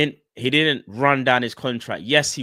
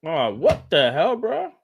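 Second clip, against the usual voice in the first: surprised outburst; 6 semitones higher, 4 dB louder, slower than usual. Real, not acted. Streamer reacts to something on screen.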